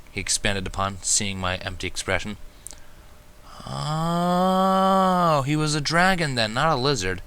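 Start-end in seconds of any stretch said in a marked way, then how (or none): none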